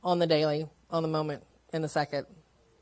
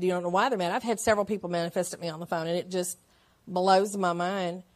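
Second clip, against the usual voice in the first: normal talking voice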